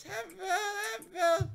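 whiny fake sob, high pitched; hammed-up crying plea; mock crying. Teased about happy pills.

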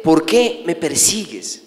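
slow question with a falling end; measured divine question, lightly voiced; questioning voice from heaven. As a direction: ask it slowly, weighing the words. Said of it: "La voz le dice".

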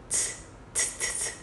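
voiceless puffs of "Ts"; aspirated c. Shown as a demonstration. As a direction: push short bursts of air through "ts", no voice. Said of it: "Đưa hàm dưới ra và có bật hơi".

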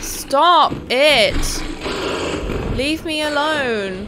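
high loud exasperated shouts; shouting at a boss and a teammate; frustrated yelling. Real, not acted. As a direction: yell in frustration. Fighting hard enemy, frustrated.

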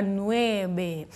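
soft, drawn-out nueve with a pout; slow, pouted, gently teasing imitation; cute pouty student. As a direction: imitate a pupil puckering up for the word. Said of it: "faz um biquinho lindo pra falar nove".

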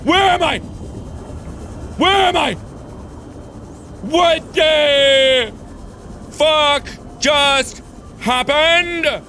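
sudden alarm after being thrown into the sea, high yelling; panicked yelling. Real, not acted. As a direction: yell in shock, lost and scared.

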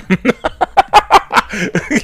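long, high cackle; uncontrolled high laughing fit; real burst of laughter. Real, not acted. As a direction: crack up helplessly. Cracks up at the plot.

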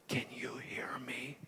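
soft unvoiced whisper close to her ear. Pastor whispering to the healed girl's ear, hushed whisper. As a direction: breathe the question right into her ear. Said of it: "I leaned up to her".

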